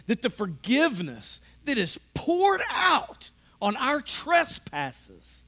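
preaching at full force. Acted. Pastor driving home lavish grace to the congregation, fervent. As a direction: preach it with rising fervor.

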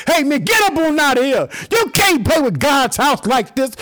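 high and loud, then slowed hard on the last line; Jesus rebuking. Retold or imitated. Jesus scolding sellers in God's house, loud slowing rebuke. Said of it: "They ain't never heard nobody say".

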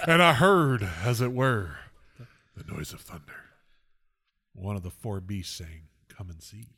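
hushed, grave Bible reading, dropping away low; mock scripture reading. Friend hams up Revelation about end times, grave and hushed. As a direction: intone it like an end-times preacher.

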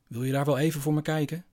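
put-on a small boy with a sudden idea; lightly voiced young child. Child Tommy asks father.

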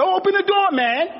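hammed-up frantic cry to open the door; shrill overexcited yell. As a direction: overblown squeal at the taxi driver. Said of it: "He's trying to say".

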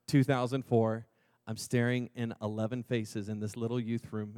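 normal talking voice

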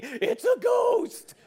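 high, loud ghost alarm; acted panicked shout, drawn out; frightened cry. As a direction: cry out as if spooked. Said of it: "people are still saying".

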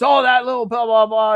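loud, high mock ranting; hammed-up indignant yelling; pissed-off. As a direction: imitate a furious drill instructor. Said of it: "he was pissed".

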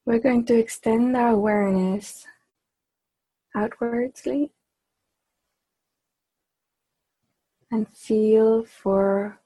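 measured meditation voice with long pauses; unhurried, evenly paced meditation leading; guided meditation. Acted. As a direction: guide the group inward, phrase by phrase. Leads a group meditation.

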